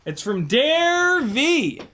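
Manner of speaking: high, loud, stretched-out name; hyped name reveal. Pretend. Host announcing who sent the package, hammed-up hype. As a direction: blare the sender's name slowly.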